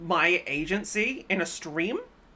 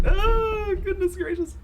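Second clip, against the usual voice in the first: surprised; 11 semitones higher, 4 dB louder than usual. Real, not acted. Reacts to odd request.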